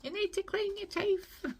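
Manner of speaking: high, mock-scolding mum; imitating mum telling her off; mum's nagging voice. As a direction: nag like a mum at bedtime. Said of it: "my mum saying that to me".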